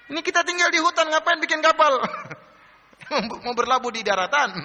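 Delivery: high-pitched jeer breaking into laughter; mocking taunt. Villagers in the forest jeer at Noah's boat, mocking. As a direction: imitate scoffers laughing at a ridiculous plan. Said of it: "Diejek Nabi Nuh".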